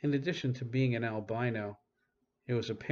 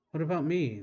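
eager plea; 6 semitones higher, 5 dB louder than usual. A narrator's reading. Child wanting to join.

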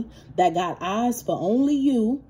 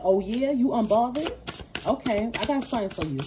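sarcastic threat; 2 semitones higher than usual. Retold or imitated. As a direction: drag it out like a sly warning. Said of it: "This person like".

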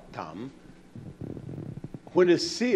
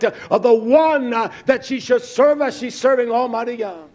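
higher, driving sermon cadence; fervent preaching; 8 semitones higher, 7 dB louder than usual. Real, not acted. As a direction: preach in bursts, hammering key phrases. Preaches at full force.

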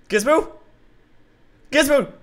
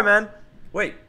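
ordinary talk

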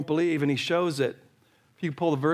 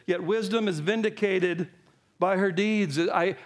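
ordinary talk, nothing marked

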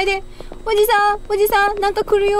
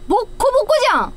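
ordinary delivery

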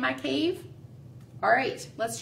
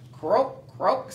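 frog croak; 3 semitones lower than usual. Acted. Teacher voicing Frog, low croak. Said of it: "said Frog".

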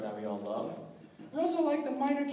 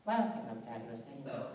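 Edna Mode; 3 semitones lower, 4 dB quieter, faster than usual. Retold or imitated. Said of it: "Edna Mode from The Incredibles".

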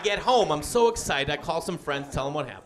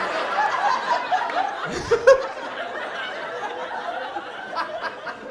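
long high airy cackle; high breathy laugh; 3 dB quieter than usual. Real, not acted. Comedian cracking up at his own story, long airy laugh. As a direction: dissolve into laughter.

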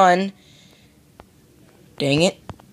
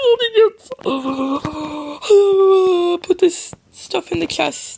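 silly high squeal. Pretend. Gamer stashing gold ingots, squealing high. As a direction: squeal it right at the top of the voice.

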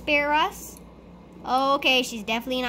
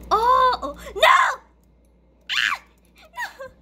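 high loud terrified no; panicked screaming; 10 semitones higher, 8 dB louder, slower than usual. Real, not acted. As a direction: shriek in panic. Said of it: "I scream a lot".

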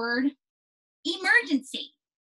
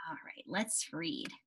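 whisper; 6 semitones lower, 14 dB quieter, slower than usual. Real, not acted. Whispered move to reading.

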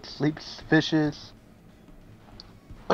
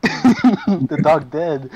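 laughing; 3 semitones higher, 8 dB louder, much slower than usual. Real, not acted. Laughing at the dog's death.

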